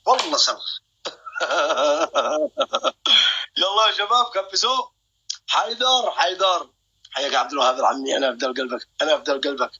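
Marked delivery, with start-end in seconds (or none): 1.0-3.5 high, rattling staccato cackle. Real, not acted. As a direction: cackle long in rapid bursts. Taunts rivals during live battle. (قهقهة عالية متقطعة)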